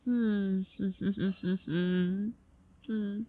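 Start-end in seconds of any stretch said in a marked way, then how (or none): 0.7-2.3 breathy little giggle; quick playful laugh under breath. Real, not acted. Playful laugh mid-scene.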